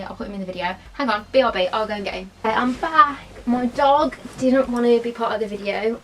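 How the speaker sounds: ordinary talk, nothing marked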